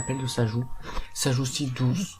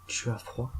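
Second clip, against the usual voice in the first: quiet menacing taunt; 7 dB quieter, slower than usual. Acted. Killer taunts the burning girl, softly menacing. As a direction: murmur it like a threat over the match.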